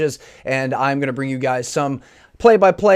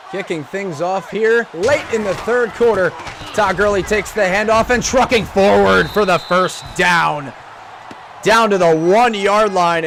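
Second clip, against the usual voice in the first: energetic play-by-play commentary; esports caster; 3 semitones higher than usual. Acted. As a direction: call the game like a live caster. Casts Madden gameplay play-by-play.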